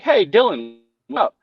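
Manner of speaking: mock upbeat, high-pitched hello; friendly phone greeting. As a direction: greet a customer brightly, imitating a caller.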